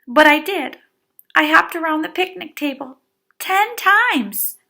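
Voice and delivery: put-on high, loud, fast child insisting; excited insistence. A narrator's reading.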